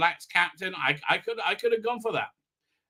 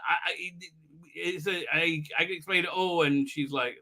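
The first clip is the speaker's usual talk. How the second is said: usual speaking voice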